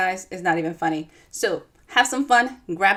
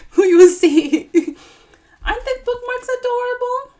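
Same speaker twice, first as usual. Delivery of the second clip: high, loud and laughing; laughing delight; 13 semitones higher, 10 dB louder than usual. Real, not acted. Showing viewers her bookmarks, laughing and gushing. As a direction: gush over the cute bookmarks.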